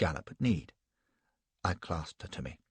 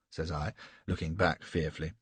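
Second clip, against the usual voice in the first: plain reading voice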